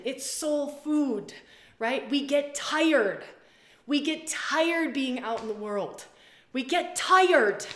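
slow, high, loud emphatic preaching; 1 impassioned preaching. Acted. To her community, preaching slowly about feminine nourishment. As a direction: lean into every word, drawn out.

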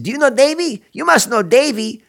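high, loud, grinning stranger's voice; eager stranger. Retold or imitated. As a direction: pester him like an over-friendly stranger at a gas station. Grinning stranger quizzes the narrator.

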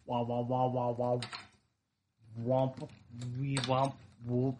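Peanuts teacher wah wah; muffled trombone-like babble; muffled wah wah talk. Pretend. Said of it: "sound like the teacher from Peanuts".

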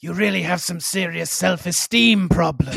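put-on regal empress voice; mock-imperious and sonorous; imitated Araxa. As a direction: deliver it as a solemn royal pronouncement. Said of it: "She should be saying".

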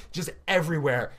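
ordinary talk, nothing marked

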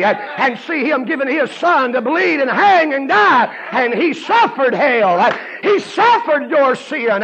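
high, loud, drawn-out chanted preaching; whooping revival preacher; fiery preaching. Acted. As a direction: preach hell at full force. Preaching at full force.

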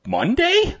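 high startled question; disbelieving pitch jump; surprised. Real, not acted. Shocked at the release date.

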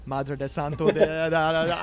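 loud, rattled-off Italian praying; hammed-up panicked Italian prayer; mock Italian prayer. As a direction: gabble a prayer in Italian, running out into nonsense syllables. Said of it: "I'm praying in Italian".

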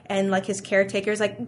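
ordinary talk

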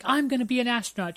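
imitated determined girl. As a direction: stand up and declare it as the girl. Imitates girl standing up proudly.

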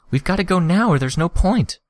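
urgent plea. A narrator's reading. Urging in danger.

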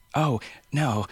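put-on a small village girl greeting strangers brightly; little girl voice. A narrator's reading. A five-year-old girl introducing herself to strangers, eager and bright. Mierna answers.

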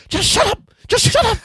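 very high, squealed mock hush; squeaky shut up. Quoting Robin Williams to friends in a squeaky falsetto. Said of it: "He goes".

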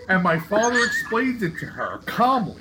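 usual speaking voice

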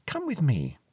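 put-on young Elspeth's light invitation; inviting young girl. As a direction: gently urge him along, pitched high.

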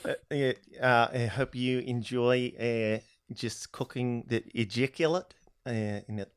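soft, halting mock New Zealand accent; hesitant, quiet New Zealand accent; Peter Jackson. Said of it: "I immediately hear Peter Jackson telling me about how to prepare".

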